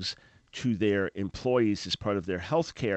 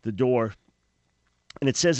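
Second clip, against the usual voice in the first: ordinary talk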